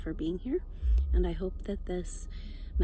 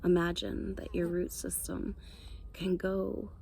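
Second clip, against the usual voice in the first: guided meditation. Real, not acted. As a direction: ease them down into calm, phrase by unhurried phrase.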